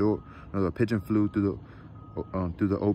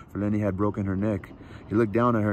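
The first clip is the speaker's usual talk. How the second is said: plain reading voice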